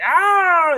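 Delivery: very high, loud comic shriek; hammed-up scream of pain; mock scream. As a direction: shriek as if bitten, playfully. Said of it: "she go like".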